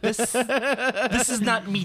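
laughing mock dismay. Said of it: "And I'm like".

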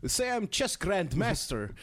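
hammed-up Russian grandmaster; Russian accent. Grandmaster challenging a friend in a mock Russian accent. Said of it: "do it in a Russian accent".

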